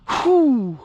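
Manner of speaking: a sharp, very high relieved whoo; high whooping exhale. Real, not acted. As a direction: let the air out in a thrilled high whoo. Exhale after top speed run.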